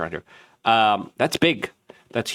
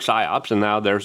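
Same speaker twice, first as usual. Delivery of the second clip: normal talking voice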